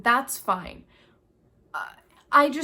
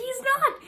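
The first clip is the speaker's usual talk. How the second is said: he's not, with NOT raised; NOT said higher, catching her own mistake; correcting herself; 4 dB louder than usual. Shown as a demonstration. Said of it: "I did write this down".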